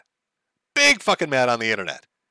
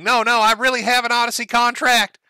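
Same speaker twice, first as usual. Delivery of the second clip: high, loud mocking whine; mock Ralph; 10 semitones higher, 4 dB louder than usual. Commentator reading the streamer's DMs in a high mocking whine. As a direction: squeal his excuses like a caricature. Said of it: "Ralph starts posting DMs saying".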